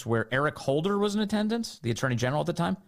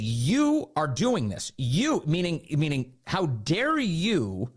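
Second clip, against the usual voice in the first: higher, slow, outraged rebuke; mock indignant tirade; 8 semitones higher than usual. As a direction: jab each how dare you like a finger in the face. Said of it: "gets in his face and he said".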